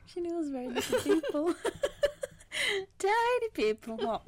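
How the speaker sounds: high, loud giggly teasing; teasing a friend's slip while laughing. Real, not acted. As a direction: laugh through the words.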